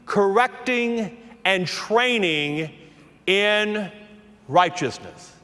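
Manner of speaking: very slow, word by word; emphatic scripture reading. Real, not acted. Pastor reading the verse over his congregation, slow and deliberate. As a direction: stretch each word, pausing after each item.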